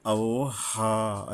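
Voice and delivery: "আওহা" recited with a long, level held "হা"; one-alif madd. Shown as a demonstration. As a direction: slowly draw out the final "হা" of "আওহা". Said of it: "এক আলিফ পরিমাণে টানতেও হবে". ("আওহা"-র "হা" এক আলিফ টেনে)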